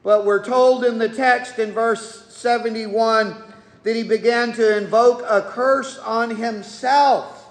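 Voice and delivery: ordinary talk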